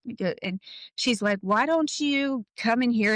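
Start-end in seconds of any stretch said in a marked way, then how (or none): 1.4-3.1 higher-pitched retold invitation; coaxing neighbor. Neighbor lady shuffling dad inside, coaxing. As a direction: imitate a neighbor ushering someone inside. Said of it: "trying to shuffle him in".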